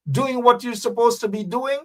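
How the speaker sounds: usual speaking voice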